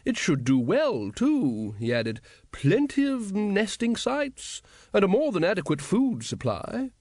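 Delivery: put-on high, slow old wizard voice; an old don waffling grandly; pompous old wizard. Old wizard lecturing the guild leaders, high and slow.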